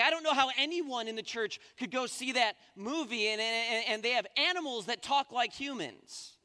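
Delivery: indignant mom. Retold or imitated. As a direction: voice her moral outrage. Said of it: "she's like".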